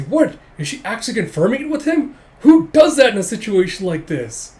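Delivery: high, loud mock disbelief; comic outrage, building to a loud demand; incredulous outburst. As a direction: burst out in hammed-up bafflement. Rival incredulous at confirmation, acted.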